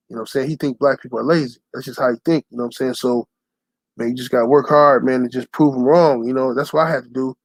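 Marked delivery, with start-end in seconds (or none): none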